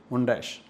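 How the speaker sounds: "montage" said low as mon-dahsh; French name pronunciation. Shown as a demonstration. Teacher confirming the French name for students, "montage" pronounced mon-dahsh. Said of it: "The pronunciation is montage".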